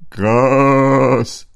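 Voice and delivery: a sheep booming its one-word answer. A narrator's reading. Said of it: "antwortete das Schaf".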